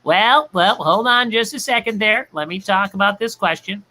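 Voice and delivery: mild mock Don voice; imitating Don. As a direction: do Don stalling the question. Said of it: "similar to Don going".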